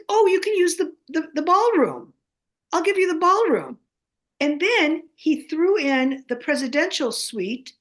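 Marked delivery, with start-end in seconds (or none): 0.1-3.8 eager offer. Retold or imitated. Retelling a generous offer.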